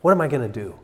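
groaned "oh", then a mock worried question; low groan of dismay into fretting; worried Pilate. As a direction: fret aloud as a ruler at a loss. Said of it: "Pilate must be thinking".

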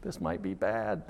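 "This might be bad" slow and soft; slow and hushed, dreading the door; low worried murmur. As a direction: mutter it under your breath. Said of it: "you're thinking".

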